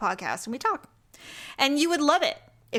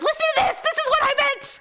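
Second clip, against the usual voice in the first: high-pitched, excited mock yell; excited shouting; 3 dB louder than usual. Imagined listener urging others to hear the podcast, excited shouting.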